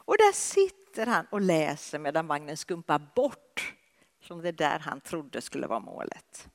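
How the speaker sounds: ordinary delivery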